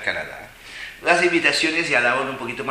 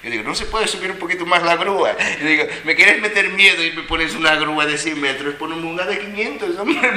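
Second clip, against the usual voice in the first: raised, laughing brag about a bigger crane; excited bragging; 7 semitones higher, 7 dB louder than usual. Retold or imitated. Telling friends about bungee jumping, excited and cocky. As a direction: pump it up, daring them to scare you.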